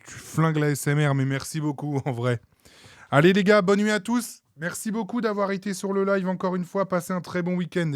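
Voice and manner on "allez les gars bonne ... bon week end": cheerful sign-off. Real, not acted. Streamer signing off to viewers.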